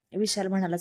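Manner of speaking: ordinary delivery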